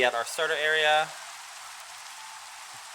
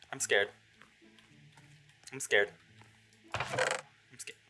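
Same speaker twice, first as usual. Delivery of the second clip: low repeated murmur, dwindling away; scared; 4 semitones lower, 6 dB quieter, slower than usual. Real, not acted. Player in a creepy place, scared. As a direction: say it low, as if to yourself.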